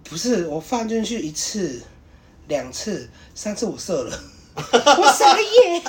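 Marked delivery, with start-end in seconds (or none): 4.6-5.8 laughing it out, high and drawn out; stretched, high-pitched laugh. Real, not acted. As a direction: squeal the words through real laughter. Laughing recalling the moment.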